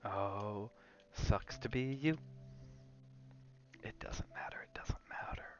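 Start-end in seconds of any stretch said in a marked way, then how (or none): none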